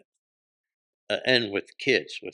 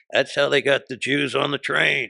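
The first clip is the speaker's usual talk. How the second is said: retold loud wisecrack; 6 semitones higher, 9 dB louder than usual. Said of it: "And I go".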